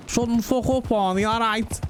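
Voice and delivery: high, hammed-up Scouse telling-off; Scouse accent. Mock Scouse boss tells off an employee at a game studio, hammed-up Scouse accent. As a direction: scold like a broad Scouse games boss. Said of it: "a Scouse accent".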